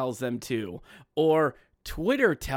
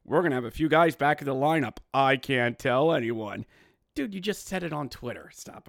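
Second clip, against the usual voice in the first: ordinary talk, nothing marked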